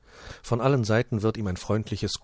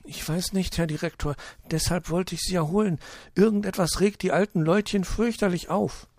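much higher, anxious woman's voice; flustered kitchen woman; 11 semitones higher, 3 dB louder than usual. Acted. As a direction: explain breathlessly to the boss. Flustered kitchen woman answers boss. (aufgeregte Küchenfrau)

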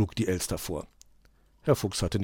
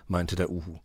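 usual speaking voice

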